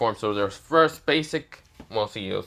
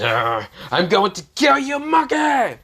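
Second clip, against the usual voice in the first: loud, rising furious villain shout; 7 semitones higher, 6 dB louder than usual. Acted. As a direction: roar it at the monkey. Frieza shouting a threat.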